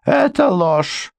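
high, slow denial; flat denial. A narrator's reading. Housekeeper denying the lawyer's claim, high and slow. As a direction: refuse to believe it.

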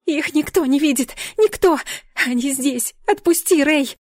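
high, desperate cries in bursts; straining shout. A narrator's reading. Heroine struggling with guard, shouting desperately. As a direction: cry out frantically to be released. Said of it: "продолжала надрываться я".

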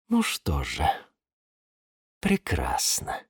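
low, slow, soft cold satisfaction; cold villain. A narrator's reading. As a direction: savor it quietly, low and slow. Calm villain watching him die.

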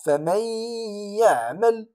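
فمن يعمل with the nun merged into ya and a two-beat nasal hum; nasalized merge. Shown as a demonstration. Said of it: "والغنة مقدارها حركتان".

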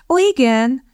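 high, coolly measured acknowledgement; aloof, chilly politeness; frosty reserve. A narrator's reading.

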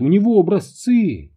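high, urgent cry of warning; alarmed shout. A narrator's reading. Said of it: "закричала Рут". (тревожный крик высоким голосом)

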